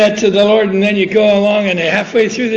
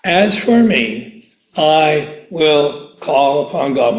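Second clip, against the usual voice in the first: slow solemn reading; 4 semitones lower, slower than usual. Shown as a demonstration. Repeats the verse slowly.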